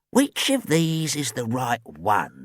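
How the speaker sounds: slow, pondering character voice; musing old man. A narrator's reading. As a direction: wonder aloud as an elderly man. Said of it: "he said, peering through his glasses".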